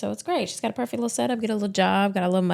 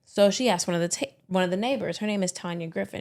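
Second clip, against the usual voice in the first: ordinary talk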